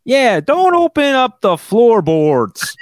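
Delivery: high, drawn-out Chicago cop. Acted. Cop warns about floorboards, slow Chicago voice. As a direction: stretch it out in a mock Chicago accent. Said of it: "I only do this Chicago voice for a cop".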